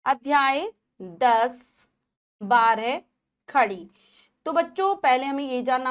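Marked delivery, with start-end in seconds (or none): 0.1-3.9 slow, loud, spaced title announcement; slow title reading. Shown as a demonstration. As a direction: read each word of the title out slowly and clearly. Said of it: "पाठ को पढ़ते हैं।".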